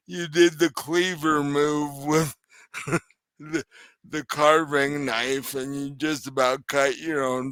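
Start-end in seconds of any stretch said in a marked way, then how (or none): none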